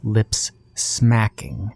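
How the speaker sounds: ordinary delivery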